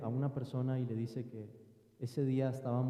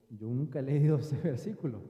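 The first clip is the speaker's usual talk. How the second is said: riendo; 4 dB louder than usual. Retold or imitated. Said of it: "yo he dicho".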